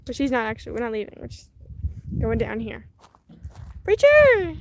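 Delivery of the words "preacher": calling out. Real, not acted. Calling the dog across yard.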